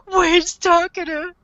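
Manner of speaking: high, whiny imitation of desperate crying out; hammed-up helpless wail; mock screaming plea. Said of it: "And he's screaming".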